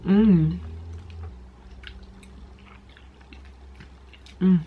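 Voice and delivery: humming while chewing; closed-mouth chewing sounds; mouth full of pasta. Real, not acted. Seen 2: Fork of pasta into mouth at 1.9; chews, lips closed throughout.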